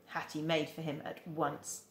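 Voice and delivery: ordinary delivery, nothing marked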